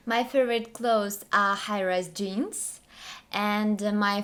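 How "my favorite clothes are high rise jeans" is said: normal talking voice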